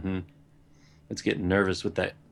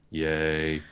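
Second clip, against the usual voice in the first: low, deflated yay; sad sullen yay; 7 semitones lower, 4 dB quieter than usual. Shown as a demonstration. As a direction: say yay with zero enthusiasm. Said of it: "just a sad, sullen".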